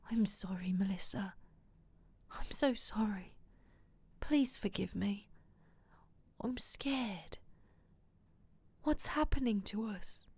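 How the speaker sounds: hushed, breathy apology; whispered. A narrator's reading. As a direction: plead in a soft half-whisper. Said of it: "and he whispered".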